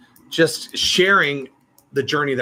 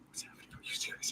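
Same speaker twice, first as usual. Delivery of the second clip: whispered aside, barely audible; tiny incredulous whisper; hushed disbelief; 21 dB quieter, faster than usual. Retold or imitated. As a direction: whisper it as if stunned.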